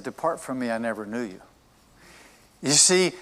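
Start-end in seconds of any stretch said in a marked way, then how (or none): 0.0-1.4 soft, subdued quoted dismissal; quiet rejection. Retold or imitated. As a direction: drop the voice well down for the Lord's verdict. Voicing the Lord's rejection of people.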